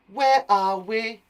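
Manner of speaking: high, slow, loud questioning voice; Jenny. Acted. Jenny asking where they are, high drawn-out voice. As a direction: call it out in a put-on high voice. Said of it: "asked Jenny".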